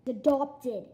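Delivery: usual speaking voice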